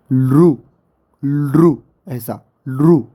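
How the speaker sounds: लू said slowly, stretched and stressed; pronunciation demo. Shown as a demonstration. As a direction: sound out लू with care. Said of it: "इसको कैसे pronounce करते हैं ये?". (लू का धीमा उच्चारण)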